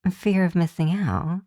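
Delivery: ordinary talk, nothing marked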